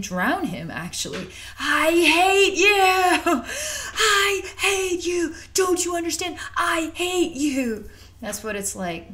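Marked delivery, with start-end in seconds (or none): 1.6-7.9 furious shout. Retold or imitated. Client snaps at captor.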